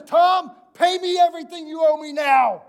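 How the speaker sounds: high, loud shouted demand; angry demand. Acted. Dave grabs Tom by the throat, shouting his demand. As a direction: throttle him with the words.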